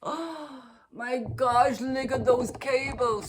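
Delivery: a gasp, then high, slow and thrilled; gasping delight. Real, not acted. Knitter showing viewers the cardie's cables, gasping and thrilled. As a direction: marvel at the cables as if seeing them new.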